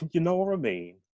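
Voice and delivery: high Brummie tag question; Brummie accent. Acted. Jesse laughing off his offer, in Brummie accent. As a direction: toss it off in high Birmingham tones. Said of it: "aren't familiar with the Brahmi accent".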